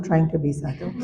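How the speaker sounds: "trying to be sadhu" said through a chuckle; amused repeat of "trying to be sadhu" with a laugh. Shown as a demonstration. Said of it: "that's why I said not sadhu".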